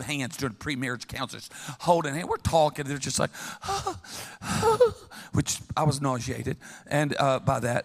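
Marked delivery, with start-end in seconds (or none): 3.3-4.9 mock gasp. Mocking lovers' gasp.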